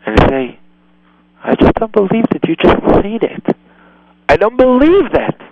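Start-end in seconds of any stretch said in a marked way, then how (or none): 1.4-5.4 higher, stunned disbelief; open-eyed shock. Shown as a demonstration. Parent to son who said shut up, stunned disbelief. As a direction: widen your eyes and let the shock lift your voice. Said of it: "Open the big eyes on him and say".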